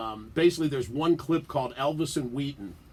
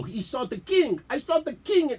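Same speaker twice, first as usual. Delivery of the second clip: a hint of a mock Spanish accent, rising higher; 10 semitones higher than usual. Buddy Pedro's skit line in a light mock Spanish accent. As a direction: playfully fake a Spanish accent. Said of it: "Spanish sort of, uh, you know, accent".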